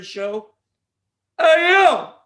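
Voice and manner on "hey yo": loud, pitched-up mock Rocky shout; hammed-up street boxer yell; bad Rocky impression. Shown as a demonstration. Host does his bad Rocky for listeners. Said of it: "the bad Rocky impression".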